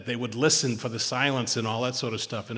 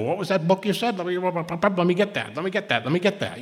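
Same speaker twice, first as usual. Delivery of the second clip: eager note-taker; 5 semitones higher than usual. Retold or imitated. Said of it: "and people go".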